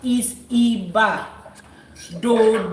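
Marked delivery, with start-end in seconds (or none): 0.0-1.2 Iba said slowly with Yoruba tones; correct Yoruba Iba. Shown as a demonstration. Teaching the diaspora client the right Iba, slow and careful. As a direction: draw out Iba, tones clear. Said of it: "if you know what Iba means in Yoruba".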